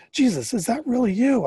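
high, loud eager shout. Acted. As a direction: shout across the water in disbelief. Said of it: "I shouted".

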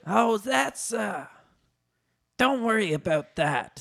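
evasive, hesitant brush-off; stall, then dismiss it; Danny DeVito. A narrator's reading.

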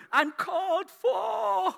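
very high, drawn-out cry; exuberant emphasis. Real, not acted. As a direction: stretch it out high for the congregation. Climax of a list of synonyms.

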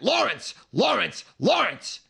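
calling a name loudly. Retold or imitated. Greeting Lawrence the bouncer-style guest by name, loud insistent call. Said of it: "you scream his name three times at him".

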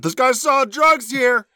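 mock yell. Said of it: "I went".

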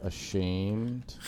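drawn-out solemn scolding; slow formal complaint. Acted. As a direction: read it slow like a stern letter. [name] reads slow mock complaint.

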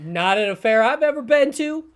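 loud, high comeback; heated rebuttal. Real, not acted. Podcaster denying co-host's cannoli claim, heated. Friends' heated food argument.

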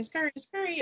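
high-pitched imitation of pupils; urgent kids. Kids alerting teacher to food in her teeth, urgent. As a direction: mimic children trying to get teacher's attention.

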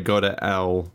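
"L" for "hell", slow; dialect-style "go to L" without the H; dropped H. Shown as a demonstration. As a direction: drop the H so "hell" comes out "L". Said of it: "dialects that just don't pronounce H's".

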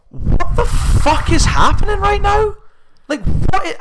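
very high, loud mock-outraged yell; incredulous outburst. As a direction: shriek it in drawn-out disbelief. Acted stunned reaction to rude worker.

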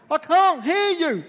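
imitated high, slow yell; shouting back over noise. Friend answering at Niagara Falls, shouting that he can't hear. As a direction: holler back over thundering water.

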